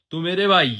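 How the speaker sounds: loud, stretched Jabalpur greeting, laughing after; Jabalpur hometown accent. Shown as a demonstration. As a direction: demo the Jabalpur accent playfully. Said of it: "ये वाला accent".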